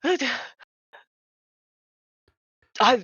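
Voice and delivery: put-on flustered old man tripping over his words; terrified older gentleman. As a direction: stammer nervously at frightening callers. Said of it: "terrified even".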